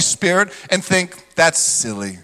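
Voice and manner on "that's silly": low, soft scornful dismissal; dismissive sneer. Retold or imitated. Religious critic dismissing the Holy Spirit, low scornful sneer. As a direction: brush it off with contempt.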